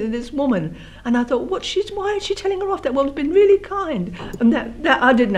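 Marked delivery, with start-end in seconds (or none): 1.5-4.1 high-pitched retold bewilderment; bewildered protest. Child watching mother rant at the kind woman, high bewildered protest. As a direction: question it in a high, puzzled voice.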